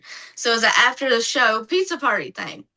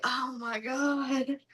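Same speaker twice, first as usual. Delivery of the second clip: dread; 10 dB quieter, slower than usual. Retold or imitated. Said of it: "And I'm like".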